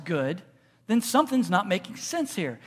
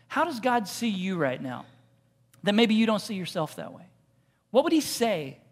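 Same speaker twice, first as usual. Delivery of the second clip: ordinary talk, nothing marked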